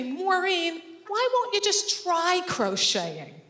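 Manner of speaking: high slow coaxing sister voice; prissy sister. Retold or imitated. Sister coaxing Maureen to crochet, high and sugary. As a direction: mimic a sister sweetly pushing crochet.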